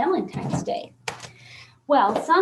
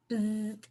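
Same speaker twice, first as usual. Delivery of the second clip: buzzing bee; 4 semitones lower, 7 dB quieter than usual. Pretend. Hint for the bee card.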